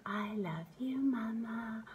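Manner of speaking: very soft, airy singsong; imitated toddler's lullaby. Mother voicing what her daughter meant, soft lullaby. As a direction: murmur it softly and tenderly, like a little child. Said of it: "So it's like she was saying".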